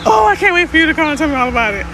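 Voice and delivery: high, rushed gush; excited gushing. Real, not acted. Host awaiting follower's story, excited gushing. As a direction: rush it out high and eager.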